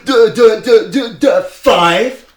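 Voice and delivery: high, loud mocking yell; angry shout at a gym-goer. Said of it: "He went".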